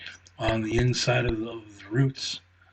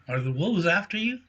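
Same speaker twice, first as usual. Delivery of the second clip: squeaky squirrel; 7 semitones higher, faster than usual. Acted. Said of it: "Squeaky asked".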